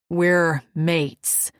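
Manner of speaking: bold declaration. Acted. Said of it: "his bold declaration".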